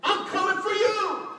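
loud, high, drawn-out war cry; big defiant yell; shouted challenge. Acted. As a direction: shout it slowly at your enemy. Challenging the devil.

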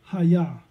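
"hayah" said as HAH-yah, falling at the end; Hebrew word hayah. Shown as a demonstration. Preacher naming the Hebrew word for the congregation, spoken with care. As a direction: give "hayah" two clear syllables. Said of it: "is the Hebrew word".

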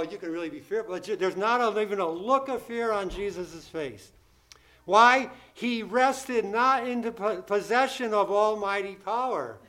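normal talking voice

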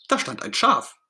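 ordinary delivery, nothing marked

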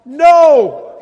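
loud, high shouted reply; one big high-pitched yell; yelling back. Retold or imitated. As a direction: holler it back across the water. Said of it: "They yell back".

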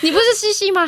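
high mocking tease; teasing. Wife teases her startled husband in a high mocking voice. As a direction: needle him playfully. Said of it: "然后我就说".

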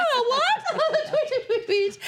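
very high birdsong tweeting, laughing; mock fairytale princess. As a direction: play a princess delighted by a bird, very high. Acting out bluebird landing on finger.

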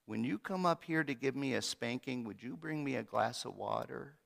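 put-on soft, sheepish child's request; little voice. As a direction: float it down the stairs, tiny and innocent. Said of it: "little voice drifted down the stairs".

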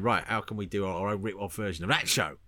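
low, lightly put-on Cockney TV executive; a touch of Cockney wheeler-dealer; Cockney accent. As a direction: do a mild Cockney exec. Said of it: "had Cockney accents".

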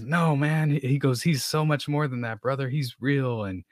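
understated earnest evangelist voice; fervent street preacher. Retold or imitated. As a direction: mildly imitate the stranger insisting. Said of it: "And he says".

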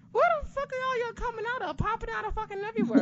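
imitating a high, fast squeal of disbelief; exasperated disbelief. Retelling Seth showing up, high squealed disbelief. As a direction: squeak it out like wrestlers keep popping up. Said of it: "I'm like".

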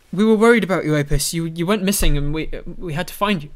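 high, loud, distressed mother voice; worried mother. Acted. Mother telling Apis they were worried, distressed. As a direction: plead like a frantic parent.

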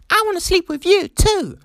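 squeaky high answering voice; high mock reply. The hookup partner answers back, high squeaky agreement. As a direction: pitch it up like the eager partner. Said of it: "There was no talk of".

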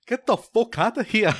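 high-pitched mock Liverpool accent; botched Scouse accent that comes out Scottish. Angry Liverpool fan confronting a rival, thick mock Liverpool accent. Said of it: "like, a guy from Liverpool".

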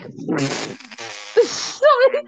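high, amused incredulous reaction; mock disbelief. Replying to a girl's exorcism question, mock disbelief. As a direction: act stunned, breaking up with laughter.

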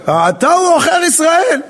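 high-pitched, indignant retort; acted accusation. King accusing the prophet, indignant and high-pitched. Ahab confronts Elijah with accusation.

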